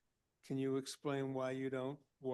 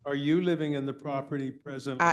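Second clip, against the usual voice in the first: raised voice to be heard; loud; 12 dB louder, faster than usual. Real, not acted. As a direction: speak up over a bad line. Chair speaking up to faint caller.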